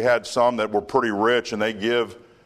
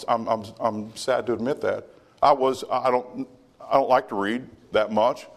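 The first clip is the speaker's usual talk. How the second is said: usual speaking voice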